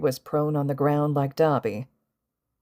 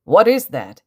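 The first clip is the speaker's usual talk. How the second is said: loud, higher alarmed question; startled; 4 semitones higher, 10 dB louder than usual. A narrator's reading. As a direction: blurt it out in shock. Hero reacts to sudden monsters.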